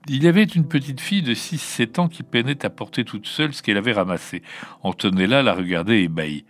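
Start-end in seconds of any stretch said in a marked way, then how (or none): none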